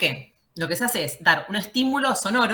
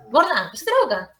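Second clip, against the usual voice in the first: raised, higher-pitched telling-off; scolding the dog; 6 semitones higher, 5 dB louder than usual. Real, not acted. Teacher to her dog mid-class, scolding. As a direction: call out sharply to the dog.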